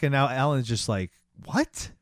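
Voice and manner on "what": high-pitched acted disbelief; high, incredulous one-word reaction; shocked. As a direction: squeak it out in stunned surprise. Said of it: "just shocked".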